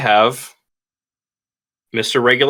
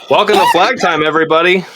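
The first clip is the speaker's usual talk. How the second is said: podcast intro; 7 semitones higher, faster than usual. Shown as a demonstration. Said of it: "can you do it without coughing".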